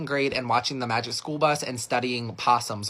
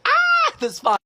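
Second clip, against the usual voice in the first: high-pitched, loud burst of glee; excited squeal; 7 dB louder, slower than usual. Acted. Kid thrilled by all the new friends, excited squeal.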